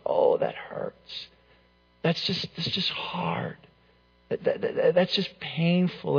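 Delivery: hushed, halting re-enactment of hurt; retold tearful hurt. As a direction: let the pain out softly and slowly, nearly whispered. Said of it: "and I started to cry".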